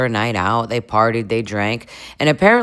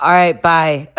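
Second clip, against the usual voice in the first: higher, louder imitated sign-off; mock goodbye; 4 semitones higher, 5 dB louder than usual. Amanda ending her complaint call, mock goodbye. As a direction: send up her goodbye with more punch.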